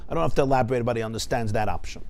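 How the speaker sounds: ordinary delivery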